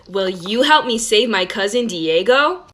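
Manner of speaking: put-on slow, drawn-out question for little kids; talking to a child. Shown as a demonstration. A cartoon girl asking young viewers for help, slow and drawn out. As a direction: stretch it out slowly like a kids' show host. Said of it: "like you're talking to a child".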